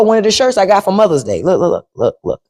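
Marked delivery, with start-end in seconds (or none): none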